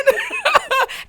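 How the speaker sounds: high laugh-cry. Real, not acted. Breaks down laughing and crying.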